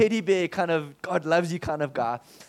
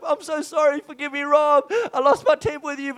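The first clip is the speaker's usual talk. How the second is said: higher, louder pleading sorry; elder kneeling to apologize, raised pleading voice; imitating Mac's apology; 8 semitones higher, 6 dB louder than usual. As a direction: beg forgiveness, high and earnest. Mac apologizing.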